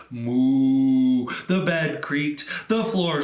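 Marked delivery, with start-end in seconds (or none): 0.1-1.3 loud lowing moo; louder, drawn-out cow moo. Acted. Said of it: "the cow said".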